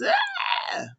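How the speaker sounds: sudden very high scream; shriek. Retold or imitated. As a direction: let out a piercing shriek. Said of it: "Kayla goes".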